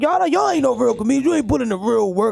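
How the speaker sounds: preachy veteran comic rant; old-school comedian voice. Retold or imitated. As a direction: lecture like a bitter old comic. Mocking old-school comedians' complaints.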